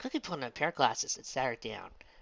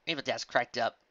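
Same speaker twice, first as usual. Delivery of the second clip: ordinary delivery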